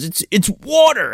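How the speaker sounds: high, drawn-out retold exclamation; mock disbelief. Co-host acting Bale spitting out the drink, mock surprise. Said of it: "he spits it out, and he goes".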